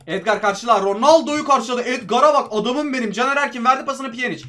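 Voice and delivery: usual speaking voice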